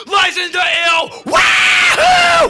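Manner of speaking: high, loud whooping shout; imitated wild glee. Kids join the destruction, shrieked whoop. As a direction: yell it, then shriek like rampaging kids.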